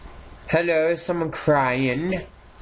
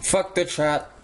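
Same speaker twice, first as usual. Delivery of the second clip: ordinary delivery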